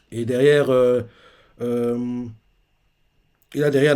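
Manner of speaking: normal talking voice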